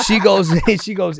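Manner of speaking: laughing through the words; can't stop laughing while telling it. Real, not acted. Laughing as he retells the airport deal.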